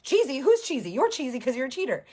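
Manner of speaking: loud, high, indignant retort; mock quarrelling. Man answers rival's cheesy insult, mock indignant yelling. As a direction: throw the insult back, mock outrage. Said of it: "they're just, like, yelling, yelling".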